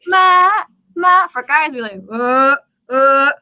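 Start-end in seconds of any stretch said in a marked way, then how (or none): 0.1-1.3 ma sung high and louder like a soprano. Shown as a demonstration. Teacher modelling the female high tone, soprano-high and loud. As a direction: hit ma at soprano height. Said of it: "I'll tell them to do soprano".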